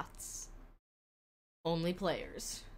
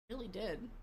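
soft repeated aside; quiet echo; 2 semitones higher, 8 dB quieter than usual. Shown as a demonstration. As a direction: echo it under your breath.

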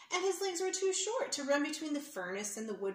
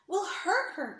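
higher, louder worried cry; 7 semitones higher, 6 dB louder, slower than usual. Acted. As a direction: cry out in alarm. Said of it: "cried a fourth leprechaun".

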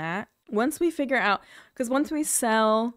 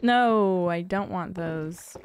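normal talking voice

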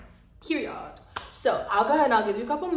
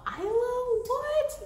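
high, squealing disbelief; excited surprise; much slower than usual. Real, not acted. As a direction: gasp out the name in delight. Excited reaction to the name.

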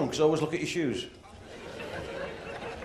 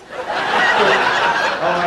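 loud breathy laugh; 8 dB louder than usual. Real, not acted. Laughing exclamation at punchline.

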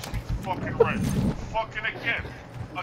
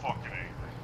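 very low, soft seething; quiet anger; 11 semitones lower, 9 dB quieter than usual. Real, not acted. Gamer too angry to yell any more, quiet anger. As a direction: say it under your voice, really angry. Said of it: "Ray's so quiet".

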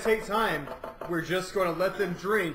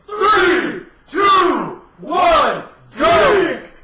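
shouted countdown; 9 semitones higher, 12 dB louder, much slower than usual. Real, not acted. Countdown to a drinking race.

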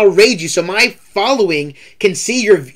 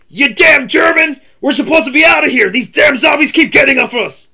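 Tank Dempsey yell; 9 semitones higher, 5 dB louder than usual. Shown as a demonstration. Streamer performing Dempsey while playing Zombies, yelling. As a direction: yell at the zombies like a furious marine. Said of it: "I'll be like".

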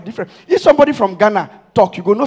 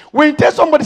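loud high call; shouting at the audience; shouted setup; 6 semitones higher, 4 dB louder, much faster than usual. Real, not acted.

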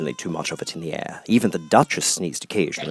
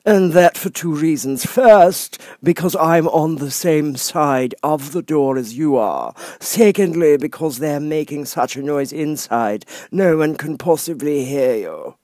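high, slow, lofty footman voice; frog footman; 7 semitones higher, 5 dB louder than usual. Acted. Frog footman dismissing Alice at the door, lofty. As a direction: talk down to her from on high.

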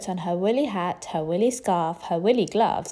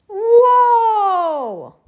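high, loud, long falling wail; king wailing in dismay; wailing whoa; 13 semitones higher, 11 dB louder than usual. A narrator's reading. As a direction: let out a hammy wail. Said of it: "wailed the king".

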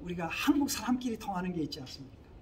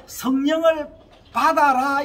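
raised, emphatic mock command; imitated loud command; 5 semitones higher, 9 dB louder than usual. Preacher imitates someone commanding believers to receive the Spirit, loud command. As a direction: call it out loud and high, like an order.

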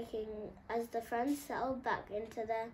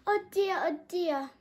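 high, louder fretful voice; lion lock frets about the monkeys; fretting lion; 9 semitones higher, 9 dB louder than usual. A narrator's reading. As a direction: worry aloud. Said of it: "Mortis, the lion-shaped lock".

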